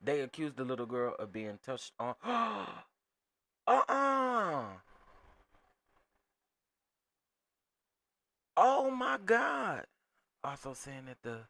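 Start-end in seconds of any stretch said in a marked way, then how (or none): 2.2-2.8 sudden shocked intake of breath; sharp gasp. Real, not acted. Reacting to the news, a sharp shocked gasp. 3.7-9.9 high, loud, slow cry of disbelief; shocked disbelief. Real, not acted. Hearing the girl was accused, a high loud shocked cry.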